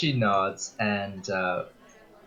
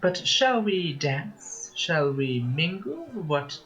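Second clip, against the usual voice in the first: magicianess, playful; 8 semitones higher than usual. Acted. Magicianess inviting Weiss onto the dance floor, high and playful. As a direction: coax Weiss to dance in a high, teasing lilt. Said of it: "Magicianess goes".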